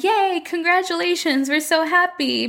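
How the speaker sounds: high, bubbly fake cheer; mock cheer. Doctors cheering the couple, high mock congratulations. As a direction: gush it out in a high voice. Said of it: "So they're like".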